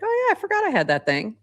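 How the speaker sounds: sudden high quick "oh yeah"; suddenly remember something forgotten; surprised realization. Retold or imitated. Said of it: "that you're like".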